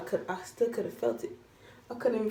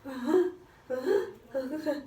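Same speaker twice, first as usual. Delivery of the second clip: higher, sobbing uh-huhs; mock sob; 4 semitones higher than usual. Patient whimpering after the tooth comes out, sobbing. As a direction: whimper through retold tears.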